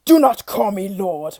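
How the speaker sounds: loud furious monster roar; roaring beast. Acted. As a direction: bellow in rage. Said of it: "roared the creature".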